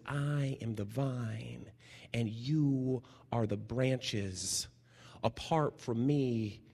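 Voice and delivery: solemn scripture reading, unhurried; slow solemn quote. Retold or imitated. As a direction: read it slowly and reverently. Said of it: "Jesus says".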